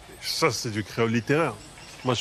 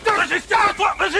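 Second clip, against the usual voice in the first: high, loud pleading; frantic restraining shout; 5 dB louder than usual. Acted. Friend tries calming Régis, frantic shout. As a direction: yell to get through to him.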